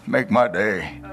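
low, gruff tough-guy line; a lightly voiced action-star growl; movie tough guy.

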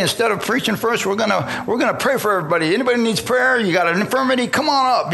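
high-pitched, rapid-fire evangelist patter. Retold or imitated. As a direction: imitate an evangelist calling people up for prayer. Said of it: "The evangelist comes out and he goes".

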